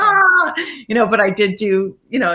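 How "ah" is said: high, loud comic "aah"; mock scream. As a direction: let out a mock horrified cry. Mock scream at nude roles.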